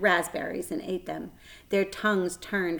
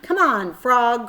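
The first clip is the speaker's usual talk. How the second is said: put-on louder, higher boy's call; crying out; 4 semitones higher, 7 dB louder, slower than usual. A narrator's reading. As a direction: call the frog eagerly. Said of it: "cried Avery".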